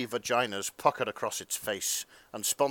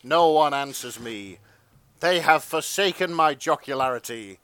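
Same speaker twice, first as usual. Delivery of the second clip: rising louder and higher into mock outrage; hammed-up wounded narrator, getting louder line by line; mock indignant narration building; 6 semitones higher, 10 dB louder than usual. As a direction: build from sulky aside to a booming proclamation.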